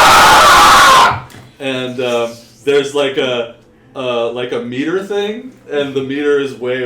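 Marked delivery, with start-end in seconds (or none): 0.1-1.3 loud, high, sustained scream; tortured scream. Acted. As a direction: scream at full force as if under torture.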